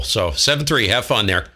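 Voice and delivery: ordinary talk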